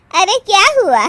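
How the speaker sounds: loud, high questioning; taken aback. Acted. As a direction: demand to know what is funny. Startled friend questions the laughter. (चौंककर ज़ोर से पूछना)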